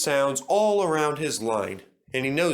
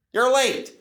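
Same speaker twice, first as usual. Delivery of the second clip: sharp, loud, higher snap; snapped; 12 semitones higher, 5 dB louder than usual. A narrator's reading. James scolding late Duncan, snappy. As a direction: bite it off. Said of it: "he snapped".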